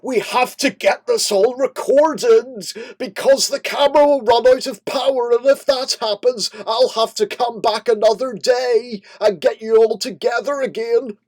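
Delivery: high, loud, rushed panicked yelling; anxious shouting. Retold or imitated. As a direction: shout it like a stressed director. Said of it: "even though I was getting a little bit antsy".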